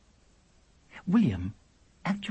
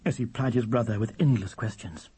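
plain reading voice

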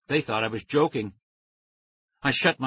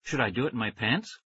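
usual speaking voice

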